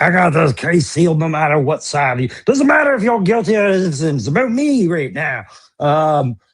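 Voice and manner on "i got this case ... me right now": arrogant prosecutor. Acted. Prosecutor boasting that it's all about him, arrogant. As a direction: brag like a self-important prosecutor. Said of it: "I'm the arrogant prosecutor".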